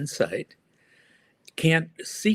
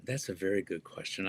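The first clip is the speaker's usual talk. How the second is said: softer, murmured to himself; quiet musing repeat; 3 semitones lower, 9 dB quieter, faster than usual. Real, not acted. Guest mulls over the host's question, quiet murmur. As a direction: echo it quietly while thinking it over.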